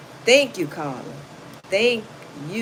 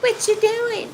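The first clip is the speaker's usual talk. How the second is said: high whiny nag; high nagging girlfriend; 11 semitones higher than usual. Retold or imitated. Nagging girlfriend whining at her man in a high voice. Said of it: "thinking you being pretty".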